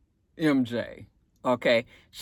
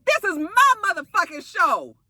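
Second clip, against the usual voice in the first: high-pitched imitation of an indignant diva; mock indignation; mimicked indignant claim; 7 semitones higher than usual. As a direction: act out her staking a claim, voice raised in pitch. Mimics Amara claiming her show.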